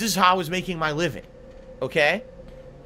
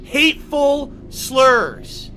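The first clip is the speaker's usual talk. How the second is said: slow furious shout; 4 semitones higher, much slower than usual. Real, not acted. Streamer raging at Twitch over slur accusation, slow furious shout. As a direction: drag each word out in fury.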